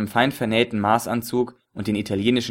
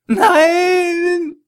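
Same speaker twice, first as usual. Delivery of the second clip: high, loud, crying scream; anguished scream; 7 dB louder than usual. A narrator's reading. Ali wird erschossen. (gequälter Schrei)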